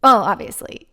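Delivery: ordinary talk, nothing marked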